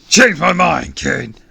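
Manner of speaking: high, booming villain call; shouting. A narrator's reading. Villain after catching the kid, shouting. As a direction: shout it with bluster. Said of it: "he shouted".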